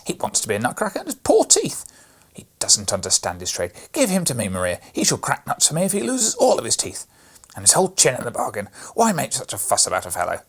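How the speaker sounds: a brat sneering and demanding the toy; bossy boy. A narrator's reading. Fred loudly demanding the Nutcracker from Maria, sneering.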